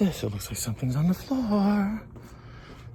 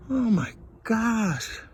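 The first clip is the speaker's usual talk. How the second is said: usual speaking voice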